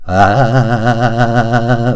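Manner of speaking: long sustained "ah" with a steady wobble; wobbling "ah". Shown as a demonstration. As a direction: hold an "ah" and make it quaver. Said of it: "So we got to wobble".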